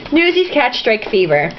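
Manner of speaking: ordinary talk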